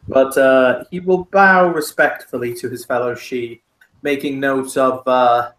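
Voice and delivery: ordinary delivery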